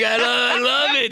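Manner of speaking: high, shouted mock-Woody gush; imitating Woody. Woody hugging his intense friend, high mock shout. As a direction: belt it up high like a giddy friend. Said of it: "He's like".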